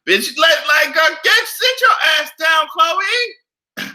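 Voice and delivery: loud, high scolding call; telling off. Real, not acted. Scolding Khloe loudly like a sassy friend. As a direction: yell at her to sit down.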